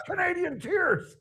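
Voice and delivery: high, gleeful reply; high mock team answer; players repeating. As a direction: deliver it like a giddy team. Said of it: "And they said".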